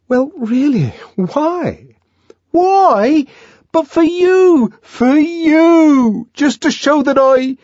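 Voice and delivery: high, loud, impassioned pleading; John, intense. A narrator's reading. John insisting to Lenina it is for her, impassioned. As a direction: burst out with feeling for her. John declares his devotion.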